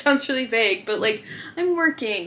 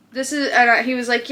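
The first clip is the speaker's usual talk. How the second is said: ordinary talk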